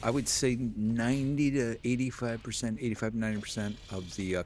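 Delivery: ordinary talk, nothing marked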